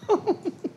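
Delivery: high, soft mock laughter; imitated laugh. Preacher imitating Sarah laughing in the tent, high giggle. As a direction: giggle as if hidden away, imitating. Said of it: "She laughed".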